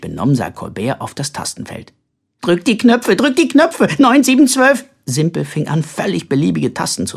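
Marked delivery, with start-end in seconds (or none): 2.4-4.8 put-on high, loud, insistent child; excited urging. A narrator's reading. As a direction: egg him on to press, pitch climbing. Child urging brother to press buttons.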